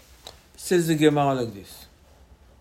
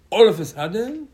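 loud, higher all of a sudden; dramatic build; 7 semitones higher, 7 dB louder than usual. Real, not acted. Rabbi springing the twist on the congregation, loud. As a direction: jolt the listeners awake.

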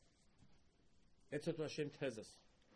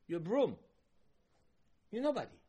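emphatic rebuke; 7 semitones higher, 6 dB louder than usual. Acted. Hashem rebuking Moshe.

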